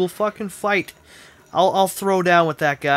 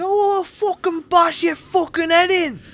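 high, slow, hammed-up London accent; 14 semitones higher, slower than usual. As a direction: overdo a London thug's threat.

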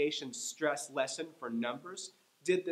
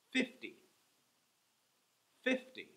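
Fifty with the first syllable high; FIF-ty, first syllable strong; stress on FIF; 10 semitones higher than usual. Shown as a demonstration. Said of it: "I'm saying each one twice".

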